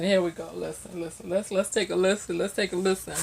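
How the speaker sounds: softly, almost under the breath; quiet teasing listen; hushed build-up. Real, not acted. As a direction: lean in and hush the room. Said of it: "hushed build-up before playing".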